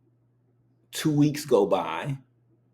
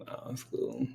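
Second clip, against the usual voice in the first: whisper; 12 dB quieter than usual. Real, not acted. Suddenly whispered.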